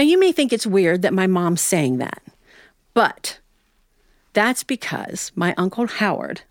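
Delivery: ordinary delivery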